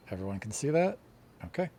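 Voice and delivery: ordinary talk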